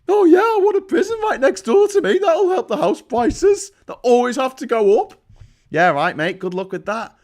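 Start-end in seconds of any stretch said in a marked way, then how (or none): 0.1-5.2 high sarcastic mock voice of a homeowner. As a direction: squeal it up high like a smug homeowner. Mocking nimby homeowner.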